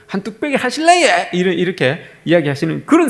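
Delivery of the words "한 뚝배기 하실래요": put-on high, sing-out Busan accent; imitated Busan accent, pitched well up; Busan dialect. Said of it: "부산 저쪽에 사투리를 쓰는 사람들".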